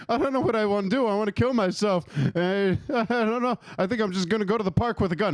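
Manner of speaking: high whiny dog voice; distressed dog caller. Pretend. A dog on the hotline, high whiny voice. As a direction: whine it out like a sad dog.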